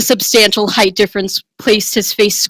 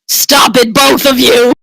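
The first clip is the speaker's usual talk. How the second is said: scolding shout; 4 semitones higher, 5 dB louder, slower than usual. Acted. Tonda stops the fight.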